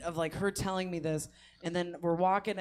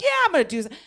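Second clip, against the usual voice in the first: defiant; 9 semitones higher, 7 dB louder, much faster than usual. Retold or imitated. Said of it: "And she's like".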